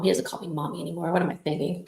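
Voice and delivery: usual speaking voice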